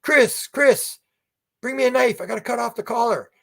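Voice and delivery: high urgent call for a knife; mock yell for help, pitched high; urgent retold shout to his son. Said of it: "he's yelling".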